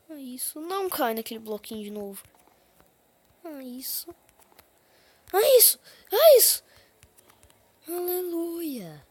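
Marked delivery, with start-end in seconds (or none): 3.4-6.6 excited cheer. Real, not acted. Cheering a successful jump. 7.9-9.0 drawn-out relief. Real, not acted. Relief after a hard section.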